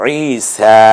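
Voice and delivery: ঈসা with both vowels held; full long vowel. Shown as a demonstration. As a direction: draw out the final "saa" of ঈসা. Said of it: "পূর্ণ উচ্চারিত হয়".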